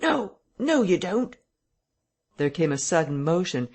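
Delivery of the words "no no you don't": high-pitched cry of protest; sharp cry. A narrator's reading. Man struggling behind the parlour door, a sharp cry of protest. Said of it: "then a sharp cry of".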